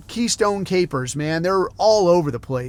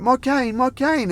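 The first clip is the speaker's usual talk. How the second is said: put-on higher, pleading old lady voice; imitating old woman; 7 semitones higher than usual. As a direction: plead for your cane like a fallen old woman.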